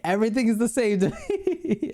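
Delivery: laughing through the line. Real, not acted. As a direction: giggle your way through it. Laughs through his own joke.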